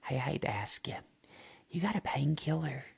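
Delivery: half-whispered, furtive aside; imitated whisper. Shelly leans over to Anna at the table, imitated whisper. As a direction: lean in and mimic a hushed request. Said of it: "She, she whis".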